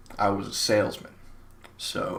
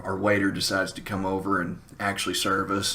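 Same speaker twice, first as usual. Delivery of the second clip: ordinary delivery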